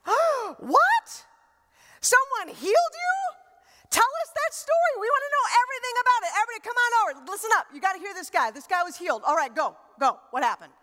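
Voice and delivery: high, loud, fast eager gushing; mock excited crowd. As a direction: hype everyone to hear the story.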